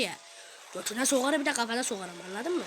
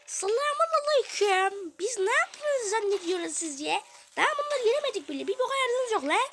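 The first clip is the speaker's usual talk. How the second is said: squeaky cartoon voice, much higher; high character voice; 9 semitones higher than usual. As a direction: voice a tiny game character, pitched way up. Oyunda bir karakteri seslendiriyor. (ince, yüksek perdeden karakter sesi)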